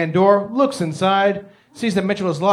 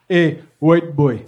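put-on African accent; 4 semitones lower than usual. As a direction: lower African-accented cab driver. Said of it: "say a big word in a funny accent".

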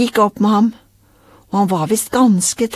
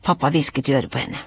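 ordinary delivery

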